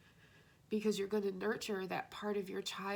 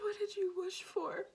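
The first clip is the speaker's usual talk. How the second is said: tearful whisper; 10 semitones higher than usual. Real, not acted. Overwhelmed by the reading.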